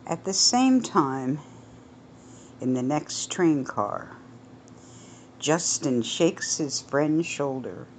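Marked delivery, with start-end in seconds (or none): none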